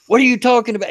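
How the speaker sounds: high, loud indignant outburst; shocked and angry protest; indignant customer. Retold or imitated.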